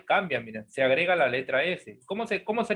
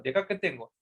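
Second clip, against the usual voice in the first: ordinary talk, nothing marked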